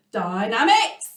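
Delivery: Dynamics said loudly; teacher models Dynamics with a lift. Shown as a demonstration. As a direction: say Dynamics out loud.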